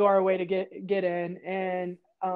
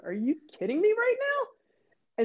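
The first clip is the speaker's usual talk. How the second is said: slow, very high incredulous question; can't believe these friends; retold disbelief; 13 semitones higher than usual. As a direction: stretch it out in mock exasperation. Disbelief at teammates falling over.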